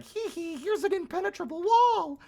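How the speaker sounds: very high, giggly taunting voice; mock the game as a cackling brat; shrill-pitched taunting with laughter. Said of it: "and then the game's like".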